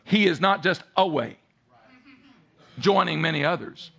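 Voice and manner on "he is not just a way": ordinary talk, nothing marked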